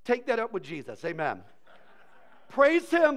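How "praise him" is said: high, loud exclaimed verse; ringing preacher's shout; loud proclamation. Acted. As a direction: call it out to the congregation.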